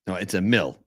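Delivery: Emil said eh-MEEL, not Amal; name correction. Shown as a demonstration. Cohost correcting the listener's name, Emil. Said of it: "you think it's Amal?".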